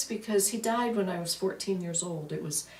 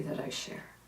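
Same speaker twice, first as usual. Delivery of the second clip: whispered; 8 dB quieter than usual. Real, not acted. Whispered end of her realization.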